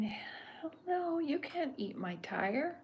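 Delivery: high, exclaimed cartoon refusal, slowing on the end; indignant character outburst. Chuck refusing to let him eat his tire, high indignant protest. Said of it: "Chuck's going".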